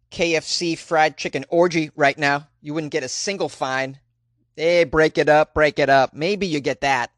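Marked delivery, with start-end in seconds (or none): 4.6-6.1 mock cop. Mock cop breaking up party.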